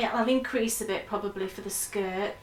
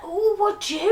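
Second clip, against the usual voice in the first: excited reply; 10 semitones higher, 5 dB louder than usual. Retold or imitated. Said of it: "And I was like".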